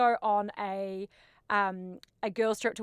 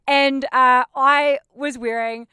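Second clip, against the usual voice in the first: usual speaking voice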